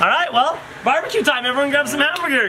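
high-pitched call to the group. Real, not acted. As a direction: announce it high to everyone. Calls the group to eat.

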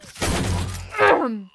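loud throat clear. Real, not acted. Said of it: "I can talk again".

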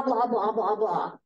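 usual speaking voice

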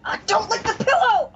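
high, strained yelp at a pet; real high-pitched scolding; scolding shout. Real, not acted. Player to Bella the licker, high scolding yelp.

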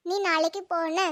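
usual speaking voice

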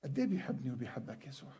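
very soft and tender, almost a whisper; tender hush. Real, not acted. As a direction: ask softly about Jesus's love. Tender question about love. (سؤال بصوت خافت وحنون)

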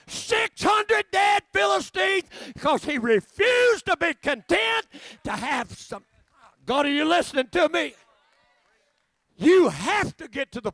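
preaching at full force. Acted. Revival preacher firing up the congregation, shouting slowly. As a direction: drive it home to the crowd.

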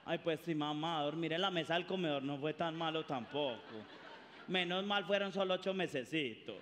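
low, soft resigned sarcasm; sarcastic daughter. Acted. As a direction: shrug it off bitterly.

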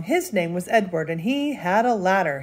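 ordinary delivery

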